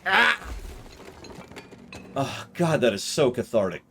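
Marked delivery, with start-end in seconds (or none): none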